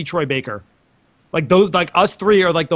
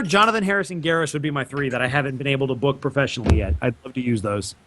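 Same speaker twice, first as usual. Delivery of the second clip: normal talking voice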